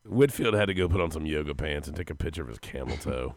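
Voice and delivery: low rough gravelly voice; deep boozy growl; whiskey voice. Retold or imitated. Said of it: "My voice sounds so gravelly".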